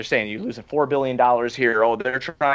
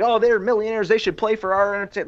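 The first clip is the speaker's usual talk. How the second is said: lightly voiced higher-pitched complainer; a hint of mock indignation; mock social media complainer; 7 semitones higher than usual. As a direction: mimic a griping fan, pitched up. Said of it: "the guy who's like".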